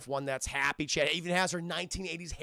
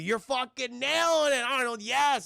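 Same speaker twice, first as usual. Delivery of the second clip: put-on high, loud kid cheering; mock excited shout of praise; 7 semitones higher, 6 dB louder than usual. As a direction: yell praise like a thrilled kid. John cheering robot on.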